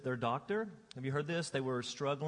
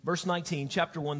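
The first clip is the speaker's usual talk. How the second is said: normal talking voice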